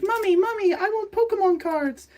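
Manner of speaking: put-on very high whiny child voice; imitating a kid begging his mom. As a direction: beg like a small child. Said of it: "they were like".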